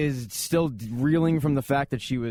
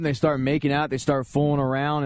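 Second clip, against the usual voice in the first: ordinary talk, nothing marked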